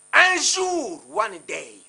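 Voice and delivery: loud, high drawn-out warning; shouted warning. Real, not acted. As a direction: call out a warning at full voice. Emphatic warning to opponents.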